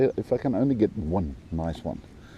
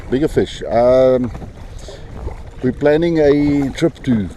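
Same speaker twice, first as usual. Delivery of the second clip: raised voice, higher and louder; shouting over wind; 4 semitones higher, 6 dB louder than usual. Real, not acted. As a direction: call out over the gusts. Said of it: "I hope you can hear me, over the wind".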